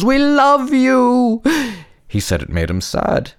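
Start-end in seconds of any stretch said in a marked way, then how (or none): 0.0-1.4 high, slow stretched cheer; cheering crowd. A narrator's reading. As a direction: call it out like fans.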